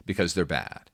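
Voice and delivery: ordinary talk, nothing marked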